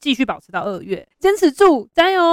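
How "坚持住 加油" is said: cheering on. Pretend. Cheering on against crowds.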